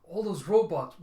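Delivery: put-on a girl crying out as the robots vanish; alarmed shout. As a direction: shout in shock at what you see. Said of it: "Effie going".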